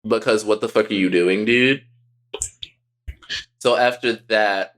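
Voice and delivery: ordinary talk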